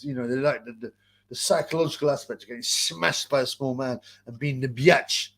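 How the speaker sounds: ordinary delivery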